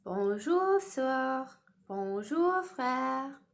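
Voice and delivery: loud, careful "Bonjour, sœur. Bonjour, frère." in French; French greeting. Shown as a demonstration. Teacher modelling French greetings for children, loud and clear. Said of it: "how we would say that en français".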